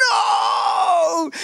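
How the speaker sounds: high shouted no of panic; shocked desperate yell; horrified cry. Acted. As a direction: realise you are doomed. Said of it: "Haman's like".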